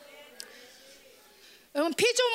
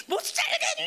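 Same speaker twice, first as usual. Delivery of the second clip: high, strained wail as the storm; hammed-up desperate shriek; raging typhoon; 12 semitones higher than usual. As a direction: scream it like a typhoon at breaking point. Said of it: "막 태풍이".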